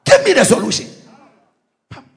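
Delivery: usual speaking voice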